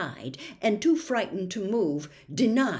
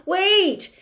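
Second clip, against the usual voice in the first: high, loud call after him; shout; 12 semitones higher, 10 dB louder than usual. Acted. Milo calls after the flying bird, loud. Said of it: "shouted Milo".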